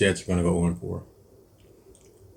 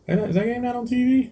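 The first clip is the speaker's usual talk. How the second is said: surprised question. Real, not acted. Surprised the game isn't televised.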